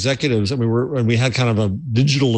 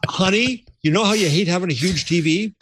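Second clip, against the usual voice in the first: higher, sweet-talking the wife; coaxing spouse; 5 semitones higher than usual. Pretend. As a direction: wheedle the wife into the purchase. Pleading with spouse over TV.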